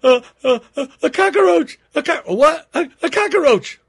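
high, loud hammed-up shrieks of fright; mock panicked shrieking. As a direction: shriek like a wife terrified of a bug. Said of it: "sees his wife on the chair screaming".

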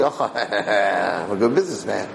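imitating a smug, laughing boaster; mock smug boast. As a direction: laugh it off as your own cleverness. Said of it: "they take credit for themselves".

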